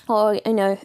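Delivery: normal talking voice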